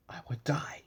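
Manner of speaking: breathy whispered warning. Acted. Beast warning Beauty he will die, whispered.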